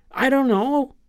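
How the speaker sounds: put-on high, small kid's voice; a child mumbling I don't know; child's voice. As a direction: shrug it off like a young kid. Kid answering about the dog.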